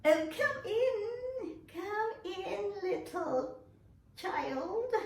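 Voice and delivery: high, slow fake granny voice; wolf puts on a sweet old-lady voice; wolf faking grandma. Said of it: "who was pretending to be Grandma, said".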